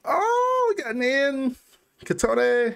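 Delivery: gasp, then high-pitched recognition; delighted surprise. Real, not acted. Fan spotting group members in a video, surprised and high-pitched.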